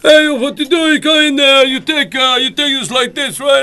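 loud, high, hammed-up Greek accent; mock Greek accent. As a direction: play a loud Greek cook showing off. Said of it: "do a Greek accent".

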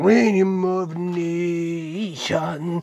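very slow, stretched-out showman's call; drawn-out announcement. Pretend. Host announcing Xenomorph Nation to viewers, drawn-out call. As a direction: stretch "Xenomorph Nation" out long, each vowel held.

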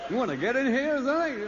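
sceptical officer. Retold or imitated. Immigration officer voiced.

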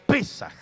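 "Pesaj" with the stress on the first syllable, the E. Shown as a demonstration. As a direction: hit the E in "Pesaj", not the A. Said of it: "Con acento en la E". («Pesaj» con acento en la E)

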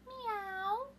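high, far-off kitten mew from a dog; cat meow. Acted. Puppy George opens his mouth and a cat meows. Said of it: "But George went".

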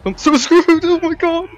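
high, loud panicked screech; panicked shriek. Real, not acted. Gamer caught by an enemy, shrieking in panic. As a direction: squeal it in sheer panic.

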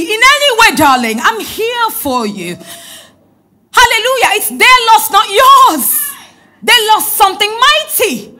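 preacher climbing to a jubilant peak; rising preaching fervor. Real, not acted. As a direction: lift the church with it. Preaching climax, hallelujah.

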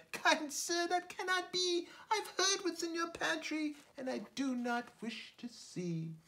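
very high small fly voice; a tiny creature repeating a warning; little fly voice. Acted. Fly warning the spider she knows his bed, high small voice.

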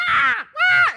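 high piercing mock scream; screaming girls. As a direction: imitate two girls shrieking at each other. Girls suddenly fighting at fence.

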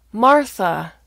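MARTHA called out loud and high; exasperated loud repeat. Acted. Daughter repeating her name to confused father, loud and exasperated. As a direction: raise your voice on Martha.

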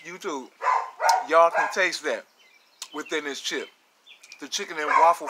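talking between bites; chewing while talking. Real, not acted. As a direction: mouth partly full. Seen 1: Chip bitten near 6.8; pursed, chewing lips through 16.7.

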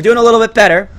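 high, loud encouragement; upbeat exclamation. Real, not acted. First take, brighter exclamation.

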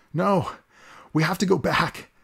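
high desperate protest; crying out. Acted. Boy held back from the edge begging to return, high cry. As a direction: plead to turn back, voice rising. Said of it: "Mao cried out".

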